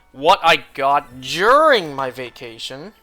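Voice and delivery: ordinary talk, nothing marked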